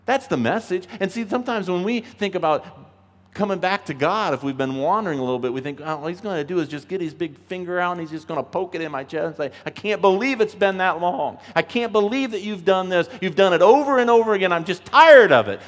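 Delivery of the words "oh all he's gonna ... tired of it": a hint of an exasperated scolder, building louder; half-hearted stern rebuke, rising to a loud finish; mock scolding. Imagined God scolding a wandering believer, mock exasperated.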